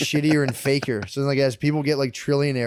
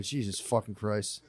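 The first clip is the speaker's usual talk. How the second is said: low, soft, slow exasperated groan; quiet groan; 4 semitones lower, 11 dB quieter, slower than usual. Retold or imitated. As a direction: groan it under your breath. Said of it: "I was like".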